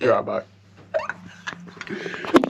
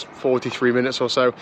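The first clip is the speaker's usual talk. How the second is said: ordinary talk, nothing marked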